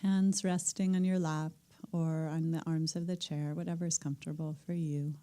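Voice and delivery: calm meditation teacher settling the room; guided meditation. Acted. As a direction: ease the group gently into stillness. Leads the group into meditation.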